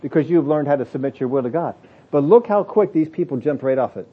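ordinary talk, nothing marked

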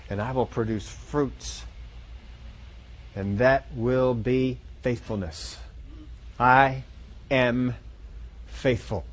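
low, soft, deliberate self-promise; quiet affirmation. Pretend. Preacher voicing a believer's mirror vow, low and soft. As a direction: speak it low and slow, word by word at the end. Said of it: "You look yourself in the mirror and said".

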